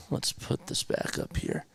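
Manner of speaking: soft unvoiced whisper. Real, not acted. Streamer adjusting camera beside the dog, whispering. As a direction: breathe the words so the dog stays calm.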